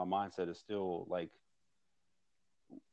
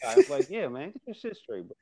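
loud mock retort; 7 semitones higher, 9 dB louder than usual. Retelling a friend's reply.

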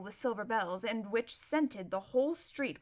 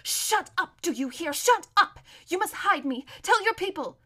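urgent, voiced stage whisper, pitched up; fierce, pressing demand; furious hiss; 7 semitones higher, 6 dB louder than usual. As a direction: order her to shut up, frantic. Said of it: "whispered furiously in her ear".

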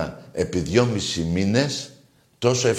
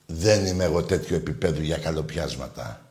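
ordinary talk, nothing marked

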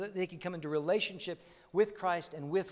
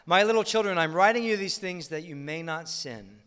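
loud, proclaimed Bible reading; preacher reading First John out loud; scripture reading; 3 semitones higher, 11 dB louder than usual. Acted. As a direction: proclaim it to the room. Reading scripture aloud forcefully.

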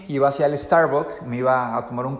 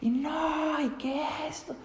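much higher, soft mock cries; imitated screams; 12 semitones higher, 9 dB quieter than usual. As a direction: mimic the far-off shouting voices. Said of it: "Estaban gritando un nombre". (imitación de gritos agudos y suaves)

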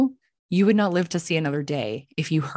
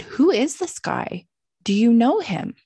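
louder, higher, pointed questioning; acted-out interrogation, pitched up; insistent questioning; 6 semitones higher, 4 dB louder than usual. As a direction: re-enact demanding answers. Acted confrontation with the girl.

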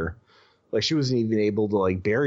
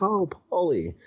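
higher, lightly voiced mother greeting her son; voiced mom; 9 semitones higher than usual. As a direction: gently imitate mom, pitched up. Voices his mom in story.

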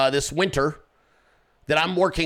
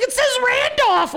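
imitating her scream; 3 dB louder than usual. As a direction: squeal like the outraged girlfriend. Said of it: "She's like".

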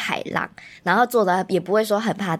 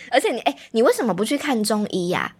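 normal talking voice

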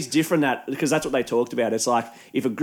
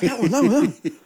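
excited guy; 11 semitones higher, much faster than usual. Retold or imitated. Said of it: "He's like".

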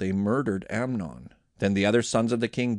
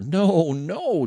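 loud, higher-pitched protest; crying out; 6 semitones higher, 5 dB louder than usual. A narrator's reading. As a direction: cry out in narrated distress. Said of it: "Tamar cried".

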